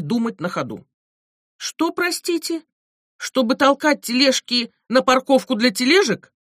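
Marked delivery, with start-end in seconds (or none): 1.6-6.3 incredulous. Retold or imitated. Narrator balks at job requirements.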